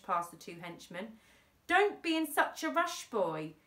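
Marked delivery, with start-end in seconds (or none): 1.7-3.6 taunting henchman. A narrator's reading.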